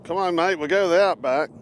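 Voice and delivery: hammed-up Australian accent; imitating Australian friend. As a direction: imitate an Aussie mate rallying you along. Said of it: "he's from Brisbane, is like".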